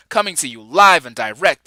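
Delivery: ordinary delivery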